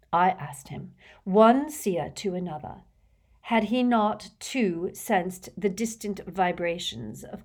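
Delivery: slow, grand complaint sinking to a hush; theatrical seer, aggrieved. Acted. Trelawney complaining about the centaur, aggrieved and theatrical. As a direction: intone it with offended mysticism.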